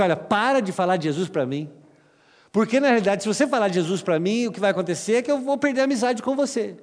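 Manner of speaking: fed-up friend pushing back, speeding up at the end; annoyed friend. Retold or imitated. Amigo irritado retrucando. (amigo irritado pedindo para parar, mais alto e agudo)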